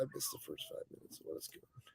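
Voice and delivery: muttered half-whisper, reading silently; mumbled to self. Real, not acted. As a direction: read it off the screen under your breath. Reading chat under breath.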